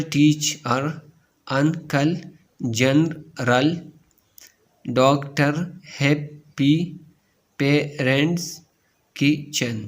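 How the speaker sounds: slow two-beat delivery, a gap inside "doc-tor", "hap-py"; split into syllables. Shown as a demonstration. Teacher showing two-syllable words, split into syllables. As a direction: break each word in two, "teach, her", "un-cle", "kit-chen". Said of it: "two syllable".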